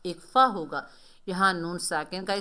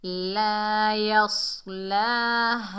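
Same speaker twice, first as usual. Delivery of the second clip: slow drawn-out chant; Quran recitation; 3 semitones higher, 3 dB louder, much slower than usual. Shown as a demonstration. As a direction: recite it long and steady. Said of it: "ला अलिफ खाली से पहले ज़बर है".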